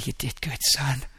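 soft, quiet and gentle; God's tender praise. Pretend. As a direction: speak like a proud, tender father. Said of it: "He's gonna say".

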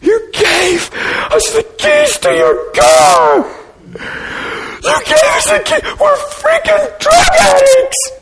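very high-pitched crying shout; bawling scream. Retold or imitated. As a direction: wail it out, overwhelmed and sobbing. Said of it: "he's bawling, and he goes".

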